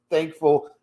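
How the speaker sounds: ordinary talk, nothing marked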